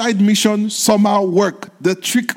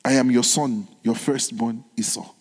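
softer, pressing on firstborn; sly quiet insistence; 6 semitones lower, 5 dB quieter than usual. Retold or imitated. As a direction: slip in the lie gently. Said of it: "I like the way he said".

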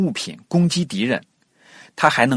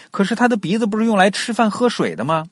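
puzzled question; 9 semitones higher than usual. Pretend. Voicing a puzzled listener's question.

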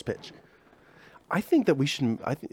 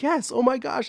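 excited; 12 semitones higher than usual. Retold or imitated. Lover agrees with excitement.

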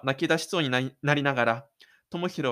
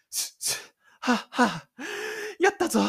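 triumphant whoop. Acted. Tomohiro running free, panting then crying out in triumph. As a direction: burst out in joy mid-run.

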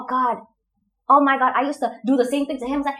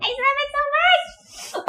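high, drawn-out gush; gushing delight; slower than usual. Real, not acted. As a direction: squeal how much you adore it. Gushing over Irish accent.

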